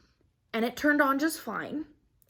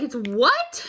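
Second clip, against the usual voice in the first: louder, rising disbelief; retold shocked reaction; incredulous; 3 semitones higher, 4 dB louder than usual. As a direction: blurt it as a stunned question. Retells shocked reply to husband.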